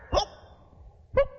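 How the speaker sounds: tiny, short imitated hoot; spent whoop. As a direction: squeak out what is left of a holler. Last feeble whoops after shouting.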